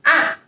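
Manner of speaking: ah high then sharp fall; fourth tone ah. Shown as a demonstration. Teacher modelling the falling fourth tone. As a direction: drop ah hard and fast. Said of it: "like this".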